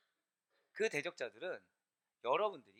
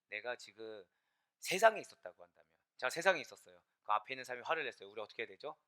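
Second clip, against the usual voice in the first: ordinary talk, nothing marked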